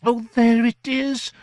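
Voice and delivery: very high, loud, drawn-out exclamation; theatrical gentleman's cry of discovery, slow and high. A narrator's reading. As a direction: cry out on spotting the lost thing. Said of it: "cried the gentleman".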